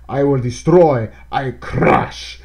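a hammed-up German villain accent, loud then breathy; mock German villain accent. Mocking a cartoon German character the game avoids, hammed up. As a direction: growl it as a cartoon German brute. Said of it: "the German character I'm referring to".

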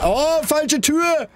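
high drawn-out frightened wail; scared scream. Real, not acted. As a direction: shriek it, slowly stretched. Opened wrong door in horror game.

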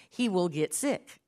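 ordinary delivery

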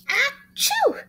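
big high sneeze; loud fake sneeze. Monkey sneezes.

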